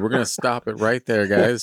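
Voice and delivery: usual speaking voice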